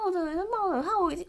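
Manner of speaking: ordinary delivery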